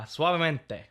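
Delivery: suavemente in a hammed-up Spanish accent, falling; Spanish accent put on for a joke. Shown as a demonstration.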